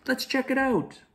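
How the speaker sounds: high, eager cub voice; eager young cub. Acted. A cub urges his friend to explore, high and eager. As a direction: pitch it up like a bold little cub. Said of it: "said Simba".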